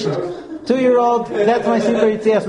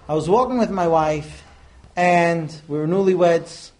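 ordinary delivery

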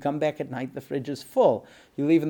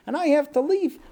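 high, louder mock lament; lightly acted reluctance, pitched way up; wistful husband; 12 semitones higher, 5 dB louder than usual. Husband sorry to leave his sleeping family, mock wistful. Husband's regretful speech in story.

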